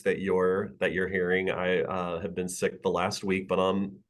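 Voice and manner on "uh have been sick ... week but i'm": ordinary delivery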